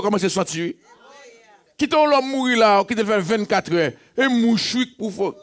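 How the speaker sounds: high, loud, ringing preacher's cry; full-force preaching. Acted. Pastor crying out to the congregation in full force. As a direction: lift the church with it.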